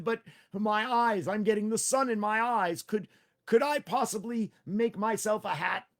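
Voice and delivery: Tricky the rider. Acted. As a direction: play the small nervous rider.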